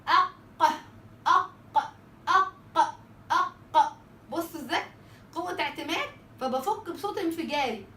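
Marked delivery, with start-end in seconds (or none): 0.1-4.0 أق with a strong closed catch, then a forceful voiced release; explosive qaf. Shown as a demonstration. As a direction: say أق with a hard stop and a burst. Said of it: "بس القاف بيبقى فيه قوة اعتماد".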